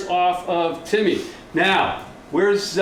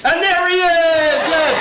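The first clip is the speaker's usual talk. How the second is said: excited showman; 8 semitones higher, 5 dB louder than usual. Acted. Presenting puppet with showman flourish.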